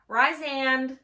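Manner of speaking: loud, high and excited; gushing reveal of her book crush; excited announcement. Real, not acted. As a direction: blurt out the name proudly.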